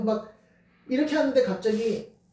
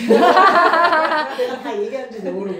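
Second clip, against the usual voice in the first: slow, loud laugh through the words; 6 semitones higher, 10 dB louder, slower than usual. Real, not acted. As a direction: laugh at her own indiscretion. Laughs about telling mother's secrets. (크게 웃으면서 느리게)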